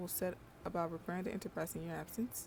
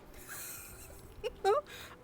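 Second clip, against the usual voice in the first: high giggle. Real, not acted. Laughing at own joke.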